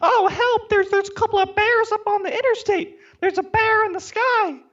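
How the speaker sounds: very high squeaky mock cry for help; hammed-up falsetto panic, mimicking a complainer; mocking whiny complainer. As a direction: put on a high, bitchy little voice. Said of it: "hear your bitchy little voice complaining".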